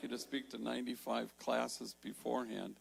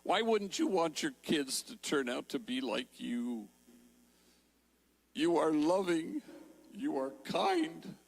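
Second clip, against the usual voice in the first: crying; 4 semitones higher, 6 dB louder than usual. Retold or imitated. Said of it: "now it's her turn to cry".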